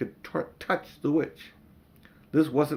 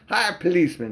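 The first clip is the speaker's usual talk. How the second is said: cockney cabby calling; 6 semitones higher, 4 dB louder than usual. Acted.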